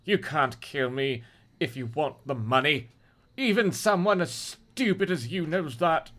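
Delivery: clenched, scornful defiance; acted tight-jawed challenge; through gritted teeth. A narrator's reading. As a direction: taunt the gunman with jaw locked. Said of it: "he said through gritted teeth".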